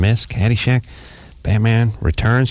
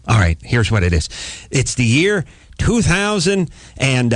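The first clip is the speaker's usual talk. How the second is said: normal talking voice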